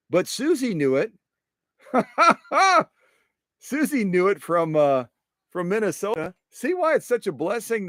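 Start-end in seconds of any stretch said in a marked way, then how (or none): 1.9-2.8 short high laugh at himself; quick bright giggle; high burst of laughter. Real, not acted. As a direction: laugh at your own goof. Laughs at own mix-up.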